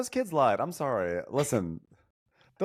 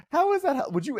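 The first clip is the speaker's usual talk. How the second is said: incredulous squeal; 3 dB louder, faster than usual. Real, not acted. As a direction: voice jumps very high in disbelief. Incredulous outburst about Shannon.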